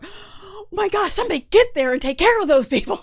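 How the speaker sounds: high, loud panicky outburst, rushing at the end; panicked exclaiming. Retold or imitated. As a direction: freak out while listening to the 911 call. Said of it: "I'm, like, going".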